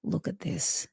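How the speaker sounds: tender loving voice. Retold or imitated. The experience speaking tenderly to her ego. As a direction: gently invite her to receive. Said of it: "obliterated my tiny little ego self and said".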